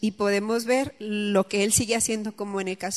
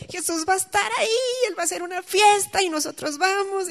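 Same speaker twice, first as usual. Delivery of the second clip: high, thrilled announcement; excited; 9 semitones higher than usual. Retold or imitated. Speaker telling children about the party with Jesus, excited. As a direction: share it like wonderful news for children. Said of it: "así como estaba emocionada".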